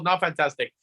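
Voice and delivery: ordinary talk, nothing marked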